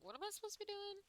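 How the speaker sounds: confused. Retold or imitated. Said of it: "And I'm like".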